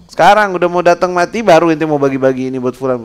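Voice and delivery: high, loud rebuke; mock his last-minute charity; scolding angel. Angel of death scolding the rich man's late giving, loud.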